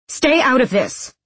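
high, sharp retort; snapped. A narrator's reading. Nina to a friend breaking up the fight, snapped. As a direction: snap at the interrupter. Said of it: "Nina snapped".

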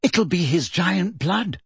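slow, shaken voice; shaky. A narrator's reading. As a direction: speak as if still trembling. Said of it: "said Hermione shakily".

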